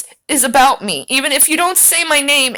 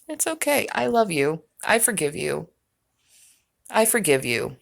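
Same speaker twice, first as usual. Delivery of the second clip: softer and lower, mock-soothing; mock tender; 8 semitones lower, 8 dB quieter than usual. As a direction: coo it like a pitying lover, tongue in cheek. Mock consoling the man in clip.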